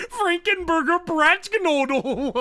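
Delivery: high, hammed-up German accent, breaking into laughter; mock the dish name in a cartoon German accent. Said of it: "stereotypical German accent".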